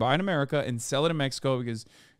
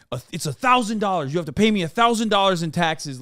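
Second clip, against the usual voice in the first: mock yelling; 4 semitones higher, 4 dB louder than usual. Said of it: "fucking yelling at me".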